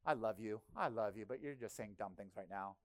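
gently amused parent tone; fond exasperation. Retold or imitated. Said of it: "God just shakes his head, being like".

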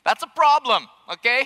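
comic indignation; emphatic exclaim. Real, not acted. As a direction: land the joke with a pop. Gravy problem exclaimed.

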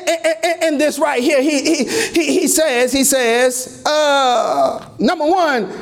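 ordinary talk

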